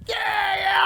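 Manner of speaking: very high shouted celebration; acted-out ecstatic yell; freaking out. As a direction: cheer like your kid just won. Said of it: "I'm freaking out".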